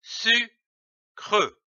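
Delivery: SU, then CREUX, as two separate beats; split into syllables. Shown as a demonstration. Teacher building a word from syllables, split into syllables. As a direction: pause between "su" and a fully voiced "creux". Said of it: "L'association de ces deux syllabes".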